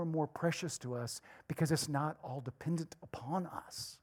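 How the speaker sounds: whispered. Real, not acted. Drops to a whisper.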